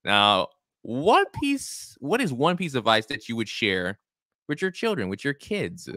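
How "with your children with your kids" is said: ordinary delivery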